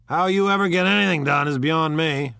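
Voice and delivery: loud, high disbelief; incredulous. A narrator's reading. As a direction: marvel loudly that it's possible. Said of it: "looked at her incredulously".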